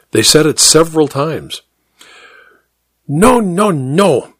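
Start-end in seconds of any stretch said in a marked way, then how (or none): 3.1-4.3 higher-pitched, mildly cross piano teacher; understated scolding, starting high; cross objection. A narrator's reading. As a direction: halt the pupil with rising impatience. Piano teacher angrily halts the pupil.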